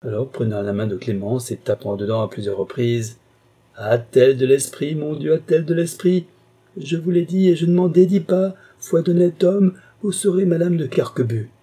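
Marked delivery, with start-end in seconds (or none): 3.8-11.5 higher and louder, a changed gushing voice; delighted outburst. A narrator's reading. Carquebus delighted with Clémence, gushing excited outburst. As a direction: gush with delight over her cleverness.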